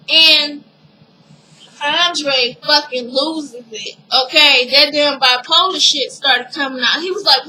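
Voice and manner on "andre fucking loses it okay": ordinary talk, nothing marked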